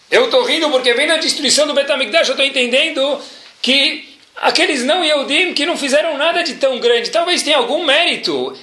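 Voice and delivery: he sees the rebuilding coming; eager explanation. Retold or imitated.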